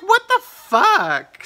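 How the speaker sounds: high, loud, drawn-out disbelief; outraged shout. Retold or imitated. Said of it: "I was like".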